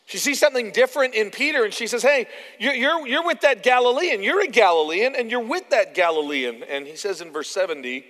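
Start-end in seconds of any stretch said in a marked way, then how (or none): 2.0-6.6 high, loud, insistent call-out; mock accusation with emphatic swings; accusing. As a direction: point the finger and accuse, raised voice. Acts servant girl accusing Peter.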